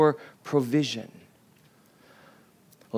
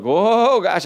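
sudden high startled whoa; 5 semitones higher, 4 dB louder than usual. Retold or imitated. Israelites startled by fire, high startled gasp. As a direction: jump back at the flames.

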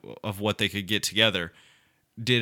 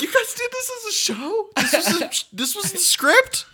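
high disbelieving words broken by laughter; imitating a castmate laughing at the script; incredulous laughing. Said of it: "he's just like".

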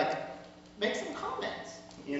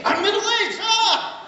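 high, loud comic outburst; mock alarm; 8 dB louder than usual. Man realizing his age to the congregation, mock-alarmed shout. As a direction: shout it out in exaggerated dismay. Mock panic at being middle-aged.

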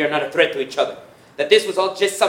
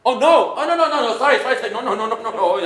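flustered, rapid over-apologizing; panicked string of sorries; apologizing too much; 6 semitones higher, 3 dB louder than usual. Acted.

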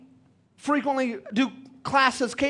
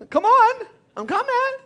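laughing retelling; 10 semitones higher than usual. Real, not acted. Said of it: "repeating his own admission, laughing".

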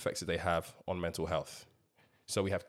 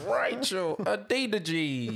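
dramatic announcer; 11 semitones higher, slower than usual. Acted. Repeating the name announcement.

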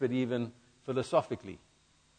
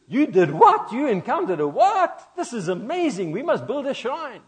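high, loud astonished outburst; mock amazement; 10 semitones higher, 6 dB louder than usual. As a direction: gush like a wide-eyed believer. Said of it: "didn't go".

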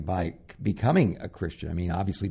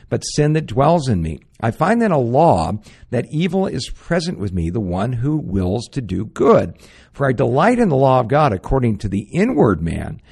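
loud, preaching Paul's words with full force; fervent scripture reading; 5 dB louder than usual. Acted. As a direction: proclaim it from the pulpit. Reads Romans seven aloud.

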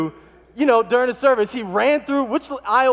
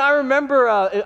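ordinary delivery

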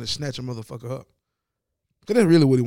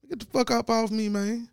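raised pitch, indignant get-off-me; mock angry snap; 7 semitones higher than usual. Player to head coach, indignant mock snap. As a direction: shake off the grabbing hand.

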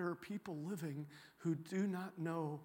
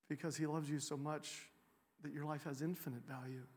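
tender reassurance; 5 dB quieter than usual. Retold or imitated. Comforting a crying stranger.

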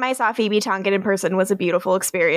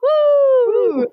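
loud high whoop; cheering woo; 14 semitones higher, 6 dB louder than usual. Real, not acted. Cheering for worldwide reviews.

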